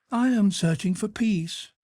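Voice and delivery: high Duchess voice. Acted. Duchess challenging Lord Henry, high and teasing. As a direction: play the witty Duchess, high and bright.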